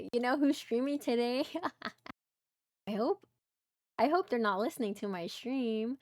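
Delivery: ordinary delivery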